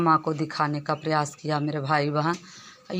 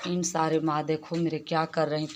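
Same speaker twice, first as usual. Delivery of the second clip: usual speaking voice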